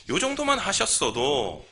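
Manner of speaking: ordinary talk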